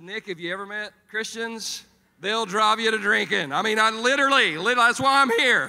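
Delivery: building to a shouted joke; comic defensive retort. Acted. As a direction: holler the excuse at a friend.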